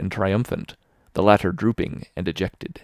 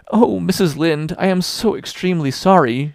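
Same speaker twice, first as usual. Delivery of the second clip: quivering apology; 8 semitones higher, 4 dB louder than usual. A narrator's reading. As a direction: beg forgiveness with a tremble. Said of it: "with a quiver in her voice".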